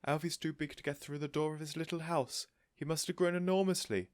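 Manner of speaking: a fussing, thrilled neighbour; bubbly older lady, amazed; excited Mrs Silver. A narrator's reading.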